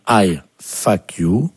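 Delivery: I fuck you, slow and word by word; dictated English lesson. Shown as a demonstration. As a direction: teach I fuck you with gaps between words. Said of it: "la première phrase pour aujourd'hui qu'il va vous falloir apprendre". (dictée lente, mot à mot)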